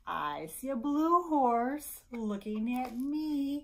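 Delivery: high, loud, slow singsong; picture-book read-aloud. Acted. Librarian reading Brown Bear to babies, slow singsong. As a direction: stretch each phrase for the babies.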